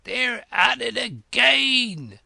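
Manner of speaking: high, loud, drawn-out shout; full-voiced shout. A narrator's reading. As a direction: bellow it at the top of your voice. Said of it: "simply shouted at the top of his voice".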